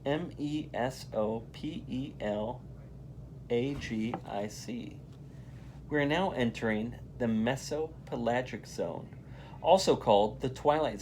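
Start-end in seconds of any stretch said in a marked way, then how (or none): 0.1-5.0 spelling out letters. Shown as a demonstration. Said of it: "another new word".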